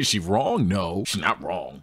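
ordinary talk, nothing marked

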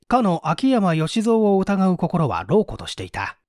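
ordinary delivery

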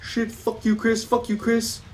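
high, frightened swearing under pressure; panicked cursing. Real, not acted. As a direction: plead and curse at the pursuer. Cursing the chasing monster.

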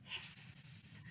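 breathy unvoiced hiss; snake hiss. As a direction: keep hissing like a snake at Square's door. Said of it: "said Triangle".

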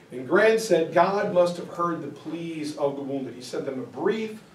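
usual speaking voice

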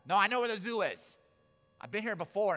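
loud, high, insistent protest; indignant, won't-be-told outburst; stubborn grandma. Acted. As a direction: insist you know better. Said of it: "My grandma was one of those".

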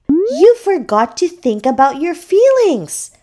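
high-pitched seahorse reminder; reminding. A narrator's reading. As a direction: remind a friend in a high little voice. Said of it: "Cece reminded him".

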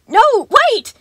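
very high, loud cry; panicked plea. A narrator's reading. Sun begging Gregory to stop, shrieking.

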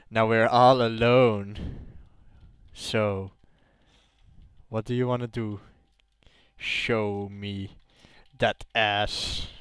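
low, soft, slow come-on; mock seduction. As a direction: play a hammed-up seducer, hushed and low. Joking seduction while playing.

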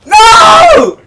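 sharp high yelled no; panicking gamer. Real, not acted. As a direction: shout it at the screen. Reacting to the game.

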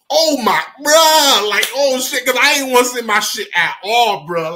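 high, whiny protest; embarrassed exclaiming. Real, not acted. Storyteller admits a cringy search, embarrassed exclaiming. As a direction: wail it in embarrassed disbelief.

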